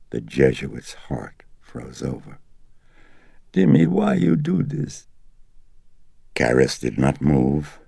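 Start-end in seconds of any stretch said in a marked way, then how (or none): 3.5-5.1 put-on high, pleading old mother's voice. A narrator's reading. Mother pleading with her son, high and sorrowful. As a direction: beg him like a hurt old mother. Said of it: "the sorrowful voice".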